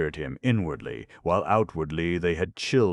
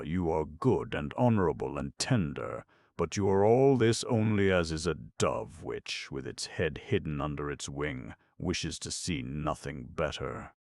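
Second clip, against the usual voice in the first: usual speaking voice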